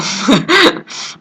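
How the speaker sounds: high burst of laughter; laughing. Real, not acted. Student laughing at own classroom story, high laugh.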